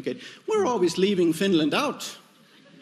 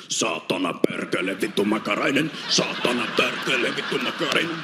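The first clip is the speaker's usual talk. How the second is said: much lower, chant of Finnish curses; angry Finnish farmers; 5 semitones lower than usual. Retold or imitated. Farmers swatting mosquitoes, low rhythmic Finnish cursing. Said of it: "by angry Finnish farmers".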